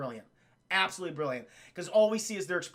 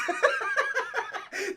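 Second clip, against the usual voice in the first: real high-pitched cackling giggle; high laugh; 11 semitones higher than usual. Real, not acted. Streamer amused by own joke, high laughing fit. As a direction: crack up in a long laugh. Cracks up at own monsters line.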